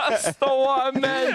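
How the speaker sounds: high, laughing protest. Real, not acted. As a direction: protest while still laughing.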